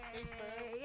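usual speaking voice

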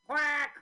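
loud high duck quack. Pretend. Imitated duck call.

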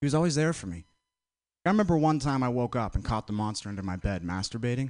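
normal talking voice

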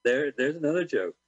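ordinary talk

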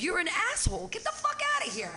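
high-pitched re-enacted outburst; retold screaming. Host retells throwing out the man, high angry yelling. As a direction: yell it up high, acting out the fight. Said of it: "All the screaming that was going on".

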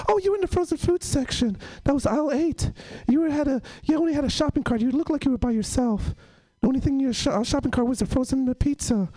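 high-pitched child impression; overly detailed kid. Kid reporting on teacher's shopping trip, high and insistent. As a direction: reel off observations like a nosy kid. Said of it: "this kid was just getting really detailed".

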